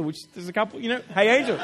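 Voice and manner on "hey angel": louder, laughing "Hey, Angel"; cheery greeting. Retold or imitated. Greeting a friend actually named Angel, loud and laughing. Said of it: "unless your name's Angel".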